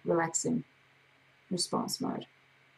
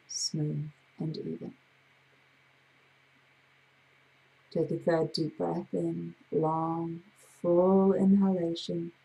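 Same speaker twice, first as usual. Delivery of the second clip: guided breathing. Acted. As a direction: talk them through each breath, calm and even. Leads a guided breathing count.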